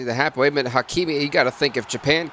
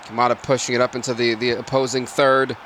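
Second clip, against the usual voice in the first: ordinary talk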